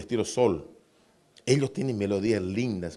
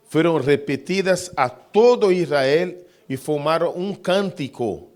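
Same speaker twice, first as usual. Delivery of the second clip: loud, measured scripture reading; solemn reading; 2 semitones higher, 4 dB louder than usual. Acted. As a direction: read the quotation with reverence. Reads inspired quotation.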